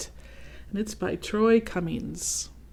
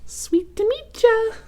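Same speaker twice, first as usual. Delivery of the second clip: very high, slow sugary squeak; high slow candy cane greeting; 4 dB louder, slower than usual. Acted. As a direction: sing it out sweet and tiny. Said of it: "called a candy cane".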